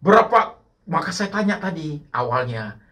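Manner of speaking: loud, high, urgent preaching; full-force preaching. Acted. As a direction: drive the questions home to the congregation.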